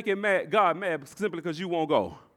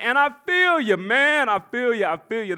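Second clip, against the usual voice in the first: ordinary talk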